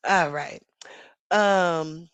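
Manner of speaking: ordinary talk, nothing marked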